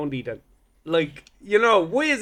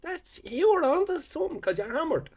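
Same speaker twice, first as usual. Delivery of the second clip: put-on drunk remark; 10 semitones higher, 4 dB quieter than usual. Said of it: "I was like".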